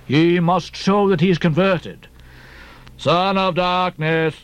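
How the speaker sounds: slow, hollow-voiced pious captain; hollow, droning pronouncement; Captain Bildad. A narrator's reading. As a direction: intone it solemnly, long drawn-out words. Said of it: "in his hollow voice".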